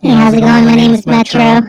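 very high, loud squeaky voice; silly cartoon chipmunk greeting; high-pitched voice. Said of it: "you wanna do a high-pitched voice?".

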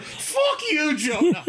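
put-on high-pitched Irish-accented outburst. Acting out a co-player's turn on Jonah, mock Irish outburst. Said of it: "In, in my Irish accent".

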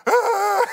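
put-on high, drawn-out kid wail; mock crying scream. Youngest joining the bedtime meltdown, high mock wail. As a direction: wail like an upset youngest child. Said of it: "he's crying and screaming".

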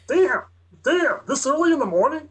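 high, friendly cop; officer voice. Acted. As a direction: reassure him like a pushy cop. Acted officer reacting.

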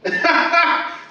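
short high delighted laugh; a quick happy laugh at the thought; light laugh. Real, not acted. Moved by the image he voiced.

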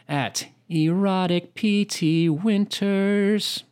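slow careful reading of a username; slow spelled-out handle. Shown as a demonstration. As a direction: spell the handle slowly for listeners. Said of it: "All right, at erotic".

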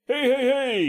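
imitating the hyped host, high and loud; over-the-top DJ greeting. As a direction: belt out a hammed-up radio opener. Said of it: "same shit over the top".

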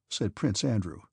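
plain reading voice

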